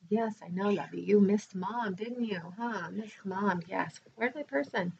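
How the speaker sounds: pet talk. Real, not acted. Speaking tenderly to her pet, soft cooing voice. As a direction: gently coo to the little dog.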